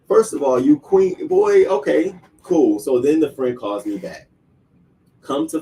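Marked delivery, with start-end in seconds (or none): 0.1-2.8 loud, high-pitched sassy rebuke; worked-up mock confrontation; indignant telling-off. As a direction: put him in his place, raised voice. Acted telling-off of the rude visitor.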